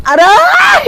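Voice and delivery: pained yelp. Acted. Sibling hurt, shouting.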